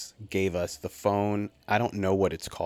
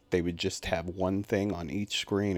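ordinary talk

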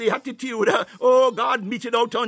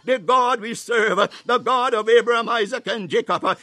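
ordinary talk

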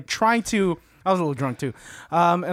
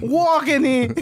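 put-on higher New York accent; 7 semitones higher, slower than usual. Teasing the cohost's Boston with a New York accent. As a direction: say it like a New Yorker. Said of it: "technically a New York accent".

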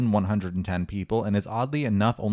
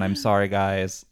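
usual speaking voice

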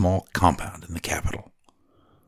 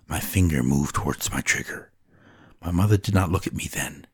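usual speaking voice

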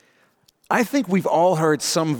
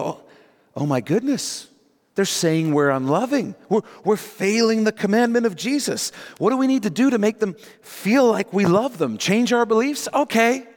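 mildly panicked, higher-pitched believer; mock fretting; 7 semitones higher than usual. Anxious believers told they are unloving, mock fretting. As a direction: fret and cave in anxiously, hammed up.

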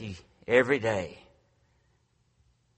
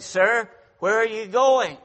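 higher, louder challenge; teacher calling out; 10 semitones higher, 8 dB louder than usual. Retold or imitated. Teacher to a student walking out of class, calling out sharply. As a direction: call after someone walking out.

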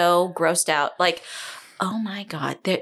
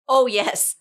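ordinary talk, nothing marked

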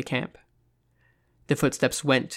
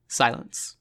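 ordinary delivery

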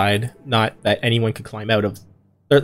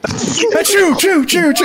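dad sneeze; slower than usual. Pretend. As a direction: belt out a big echoing dad sneeze. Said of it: "[name] has a full-on dad sneeze".

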